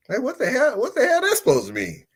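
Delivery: high, indignant what the hell; incredulous comedian rant; outraged disbelief. Real, not acted. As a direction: pitch up in mock outrage. Outraged at the compliment.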